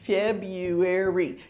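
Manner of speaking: February said slowly as feb-yoo-ary in four syllables; slow model word. Shown as a demonstration. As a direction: stretch out February, skip the R. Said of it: "it has four syllables".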